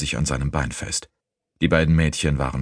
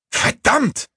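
very high, explosive curse; cursing; 4 dB louder than usual. A narrator's reading. Sneaton cursing as master runs into fire, high outburst. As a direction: spit out the swearword in alarm. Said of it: "fluchte Sneaton".

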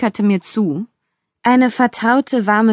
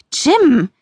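higher, sharp cry of the name; called out; 6 semitones higher, 4 dB louder than usual. A narrator's reading. Woman silencing her demon dog, calling out. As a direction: call out to cut someone off. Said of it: "rief ich und legte beide Hände".

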